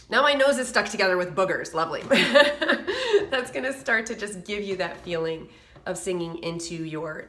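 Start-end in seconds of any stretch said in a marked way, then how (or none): 2.1-3.3 laugh. Real, not acted. Nose stuck with boogers.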